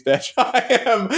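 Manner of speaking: ordinary talk, nothing marked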